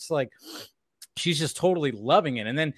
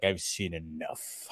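low villain mutter; 9 semitones lower, 10 dB quieter, slower than usual. Retold or imitated. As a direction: lower and softer, a hint of a cold Lex. Voicing Lex, lower and softer.

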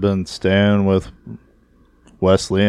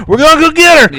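very high, loud imitated yell; mock huffing shout; 10 dB louder than usual. Imitating the frantic father running down the hallway, high mock shout. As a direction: burst into a hammed-up, high-pitched holler. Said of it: "Vince is huffing and puffing".

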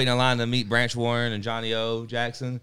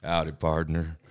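cowboy greeting, lower voice; fake cowboy accent; 8 semitones lower, 3 dB quieter than usual. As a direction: lower drawled howdy partner. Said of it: "He's like".

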